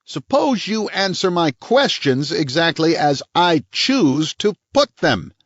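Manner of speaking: high, slow, cold cop voice; hard police chief. A narrator's reading. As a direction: lay down the law, slow and sharp.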